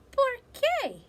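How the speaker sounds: high, loud astonished "why?"; surprised question. Acted. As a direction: squeak it out in shock. Puzzled dog asks.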